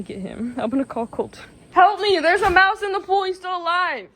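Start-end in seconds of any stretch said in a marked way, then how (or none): 1.7-4.1 high, loud yelling for help; panicked shout. Real, not acted. Yelling to the house about a mouse in the pool, high panicked shout. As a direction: call out in real alarm about a mouse.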